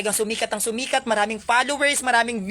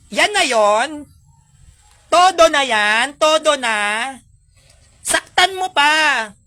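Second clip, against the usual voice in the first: loud, slow dare to hurt you more; defiant taunt; 5 semitones higher, 8 dB louder, slower than usual. Pretend. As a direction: shout a daring challenge at the bashers. Taunting bashers to hit harder. (malakas at mabagal na paghamon)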